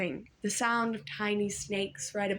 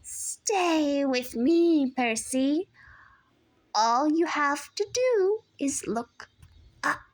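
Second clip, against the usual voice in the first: put-on slow, high luring old woman's voice; sweet monster; 6 semitones higher, 4 dB louder, slower than usual. A narrator's reading. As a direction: coax him to look up. Medusa luring Percy.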